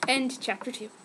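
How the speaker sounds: usual speaking voice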